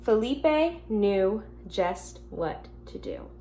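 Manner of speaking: gentle storybook reading to the class; reading to children. Acted. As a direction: read it like a teacher at story time.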